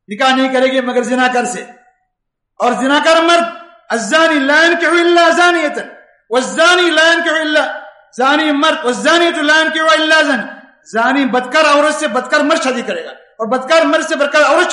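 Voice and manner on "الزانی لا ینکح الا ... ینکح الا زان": Quranic recitation. Acted. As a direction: recite it out with feeling.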